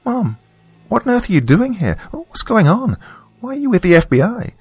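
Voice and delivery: a daughter firing questions at her mother; bewildered daughter. A narrator's reading. Daughter voiced in story.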